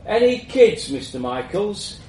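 colleague voice. A narrator's reading. Colleague asks the boss.

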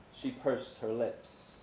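ordinary delivery

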